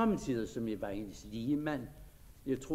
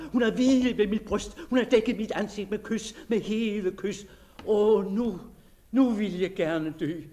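louder, breathless wonder after a night of love; rapturous disbelief; 6 semitones higher, 6 dB louder than usual. Acted. Diarist reliving his lover's embrace, rapturous and alarmed. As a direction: let the joy spill over into fear of madness.

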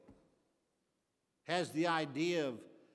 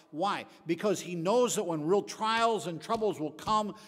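louder, rising preacher's push; forceful preaching; 5 dB louder than usual. Acted. Pastor urging the church, forceful preaching. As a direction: drive the point home to the congregation.

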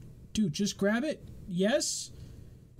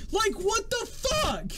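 exasperated rant; 13 semitones higher, slower than usual. Real, not acted. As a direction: rant at the absurd game. Ranting about the spinning hammer.

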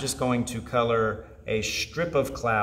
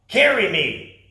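loud, higher bossy demand; 8 semitones higher, 9 dB louder than usual. Acted. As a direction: order them to carry you. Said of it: "he demanded".

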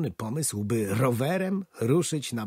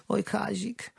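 fretting wife saying goodbye; lightly voiced anxious wife; worried wife; 10 semitones higher, slower than usual. A narrator's reading.

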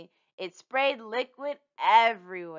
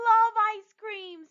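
gushing; 14 semitones higher than usual. Acted. Rosa gushing about ice cream.

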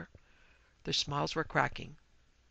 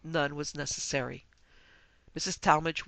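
ordinary delivery

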